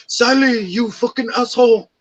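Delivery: loud, high, angry mock Turkish accent; scolding in a put-on Turkish accent. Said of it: "he has this Turkish accent".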